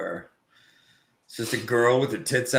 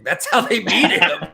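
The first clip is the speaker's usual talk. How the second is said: ordinary delivery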